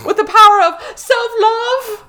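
high, mocking sweep on self-love; sarcastic inspirational voice; mock grandiose. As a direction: parody the book's sappy moral. Mocking the book's moral.

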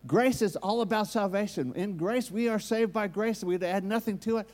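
preaching at full force. Acted. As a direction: press every point home to the hall.

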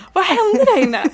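imitating a shocked sister. Sister asking after leaving subway, shocked and high. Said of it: "hon är helt chockad".

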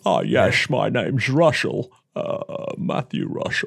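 a touch of a suave Bond hero, repeated; Roger Moore impression. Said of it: "It was a very good Roger Moore".

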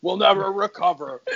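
high-pitched melodramatic outrage; outraged. Retold or imitated. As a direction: wail it like a hammed-up complaint. Acted quote of an upset complainer.

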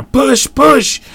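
high urgent yell; frantic shout. A narrator's reading. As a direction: shout while pushing hard. Pushing the door.

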